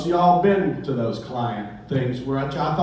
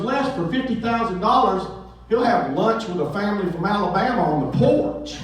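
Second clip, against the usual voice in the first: an agent who'll sell anything for cash; breezy agent; 7 semitones higher than usual. Retold or imitated. Agent answers Les.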